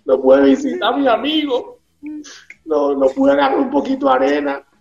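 hammed-up crybaby complaint; mock whining. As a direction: whimper like a cheated passenger. Mocks sad fake flight passenger.

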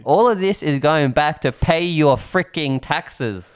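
slow, emphatic public scolding; mock lecture. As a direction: spell it out slowly for the taxpayers. Mock lecture to Greek friends.

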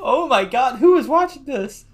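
very high, loud disbelief; loud high-pitched amazed question; incredulous outburst. Real, not acted. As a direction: cry out in real astonishment. Loud disbelief at seven seasons.

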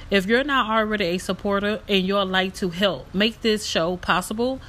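upbeat sponsor read, loud. Acted. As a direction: read it like a podcast promo. Recorded support ad read.

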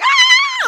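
very high, loud mock scream; horror-movie scream. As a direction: shriek like a horror film victim. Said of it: "I'll start screaming".

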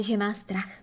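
ordinary delivery

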